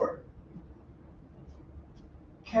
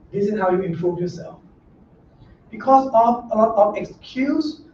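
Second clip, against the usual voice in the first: ordinary talk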